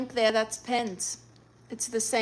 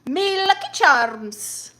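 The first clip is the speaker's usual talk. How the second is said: high, slow mock Irish catchphrase; badly faked Irish accent; terrible Irish accent; 6 semitones higher, 4 dB louder, slower than usual. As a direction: stretch it out in hammed-up bad Irish. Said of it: "It's a terrible Irish accent".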